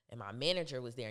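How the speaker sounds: usual speaking voice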